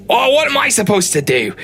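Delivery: a high, whiny protest; whiny old wizard. Acted. Archimedes whines back at the dwarf in a high, put-upon voice. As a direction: throw up your hands and whine. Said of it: "He says".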